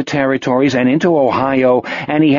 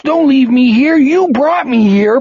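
weeping plea; 12 semitones higher, 4 dB louder than usual. Acted. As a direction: sob it out, voice way up. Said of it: "he wept".

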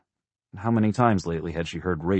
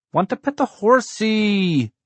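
put-on very high, drawn-out little-boy yell; child's yell; 7 dB louder, slower than usual. A narrator's reading. Small boy kicking Dad's seat, yelling his demand. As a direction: yell it slowly at full pitch, stretching the last word. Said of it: "Blake yelled".

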